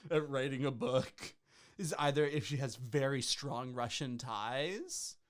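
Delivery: ordinary talk, nothing marked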